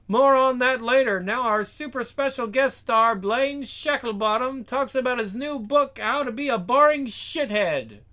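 high, projected show-host patter; TV presenter. Acted. Presenter introducing super special guest star, high announcing voice.

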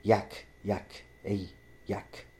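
imitating "Yak" with a y and a hard k; short, separated "yak" as others mangle it; Spanish mispronunciation. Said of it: "Fucking Spanish".

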